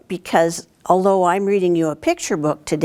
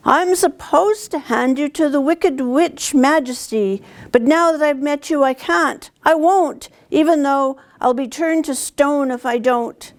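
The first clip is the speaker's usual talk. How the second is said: tearful confession; 9 semitones higher than usual. Acted. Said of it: "began to weep so hard".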